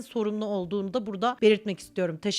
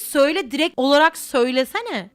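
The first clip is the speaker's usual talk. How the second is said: impatient, nearly yelling at the sender; loud exasperated demand; 3 semitones higher, 9 dB louder than usual. Pretend. Answering a vague DM sender with a loud, impatient demand. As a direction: shout it out, much louder: just say it.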